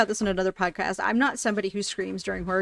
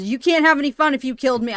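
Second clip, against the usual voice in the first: mock-indignant, louder and higher; mad; 5 semitones higher, 5 dB louder than usual. Player telling off whoever killed her, mock mad. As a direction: tell the killer off, raised voice. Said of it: "I get very mad at the".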